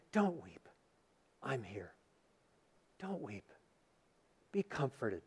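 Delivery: very soft, hushed and gentle, almost a whisper; tender comfort. Acted. Jesus gently hushing the widow at her son's funeral, soft and tender. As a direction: soothe a weeping mother softly.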